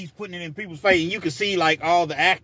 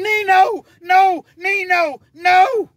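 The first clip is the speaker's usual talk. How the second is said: pleading shout; 9 dB louder, much slower than usual. Retold or imitated. Comrades restraining him, pleading.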